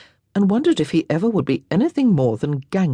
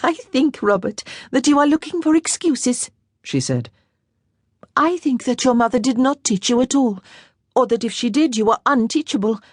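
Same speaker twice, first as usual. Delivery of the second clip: put-on lightly raised girlish voice, playful; girlish teasing; teasing young lady; 8 semitones higher than usual. A narrator's reading. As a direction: tease him, a young girl's voice. Girl teasing him.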